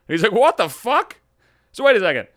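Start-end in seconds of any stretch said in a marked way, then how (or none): 0.3-1.2 higher-pitched disbelieving exclamation; incredulous outburst. Acted. Buddy reacting to the agent's news, incredulous. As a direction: act out a baffled, indignant reaction.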